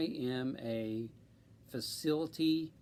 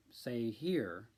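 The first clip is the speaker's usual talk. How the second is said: ordinary talk, nothing marked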